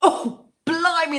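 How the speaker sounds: real gasp at a strong drink, drawn-out start; reacting to strong drink. Real, not acted.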